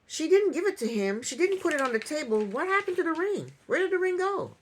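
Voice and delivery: high-pitched, incredulous puzzlement; baffled questioning. Real, not acted. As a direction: ask in rising disbelief where on earth it went. Baffled over the missing ring.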